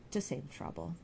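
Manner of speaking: ordinary delivery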